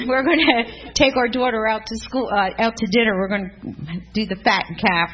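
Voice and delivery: loud, high, thrilled shouting orders; husband's excited call. Retold or imitated. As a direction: call it out like a party's coming. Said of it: "he's like".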